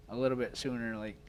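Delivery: ordinary talk, nothing marked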